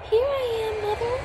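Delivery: high, loud calling; high shout to a plane. A narrator's reading. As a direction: call across to the plane. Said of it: "he called out".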